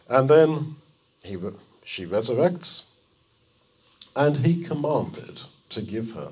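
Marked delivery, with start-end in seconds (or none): none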